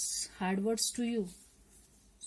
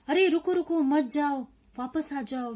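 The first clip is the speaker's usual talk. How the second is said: loud, high plea to halt; alarmed warning; 5 semitones higher, 6 dB louder, much faster than usual. Acted. Translating the poem's warning to the child, alarmed warning. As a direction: call them back urgently.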